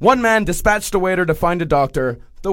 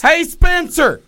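high, louder call across a room; 11 semitones higher, 4 dB louder, slower than usual. Retold or imitated. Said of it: "Mister Lord shouted from across the room".

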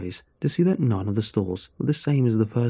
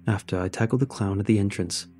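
plain reading voice

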